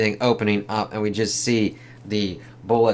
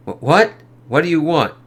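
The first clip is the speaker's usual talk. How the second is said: startled soldier; 7 semitones higher, 7 dB louder than usual. Acted. Startled soldier faces Hulk.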